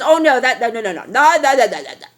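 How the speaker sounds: hammed-up rapid no no no; mock denial. [name] mocking the commenters who deny it, hammed-up. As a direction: mimic the doubters brushing it off.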